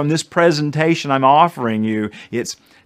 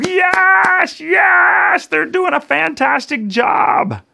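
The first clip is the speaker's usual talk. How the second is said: elated cheer; 3 dB louder, much slower than usual. Pretend. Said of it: "they're going".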